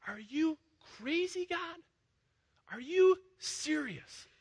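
hurt disbelief under her breath; incredulous complaint. Acted. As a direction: Hagar doubting God, high and hushed. Imagined Hagar's disbelief toward God.

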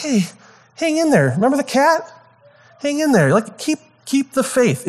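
high, sing-song comforting friend; pep up a struggling friend with a sugary lilt; sappy encouragement. Retold or imitated. Friend cheering a pal through a rough week, sappy high sing-song. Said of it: "and you're like".

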